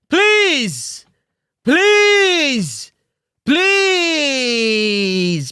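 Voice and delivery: hammed-up drawn-out pleading; mock desperate begging, wailed pleases. Host mimics coach pleading to play here again, hammed-up begging. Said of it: "Coach is begging".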